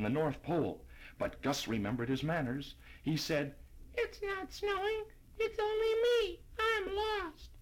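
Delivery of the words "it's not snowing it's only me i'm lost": put-on very high tiny boy voice; tiny snowflake voice. A narrator's reading. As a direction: squeak it like a lost little snowflake. Little snowflake Gus speaks.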